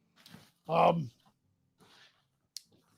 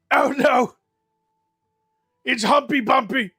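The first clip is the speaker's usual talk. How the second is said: high, loud mock panic; freaking out; 8 semitones higher, 8 dB louder than usual. Townsfolk spotting the hunchback in town, freaking out. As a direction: cry out like alarmed townsfolk. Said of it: "everybody would start freaking out, going".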